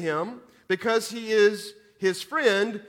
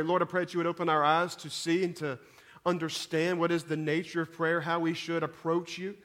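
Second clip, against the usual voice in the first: softer, lower praying voice; prayer; 3 semitones lower, 4 dB quieter than usual. Real, not acted. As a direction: pray softly and earnestly, in a low voice. Leads the congregation in prayer.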